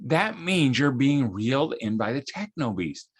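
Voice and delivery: usual speaking voice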